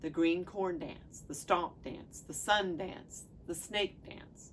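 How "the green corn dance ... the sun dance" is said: ordinary talk, nothing marked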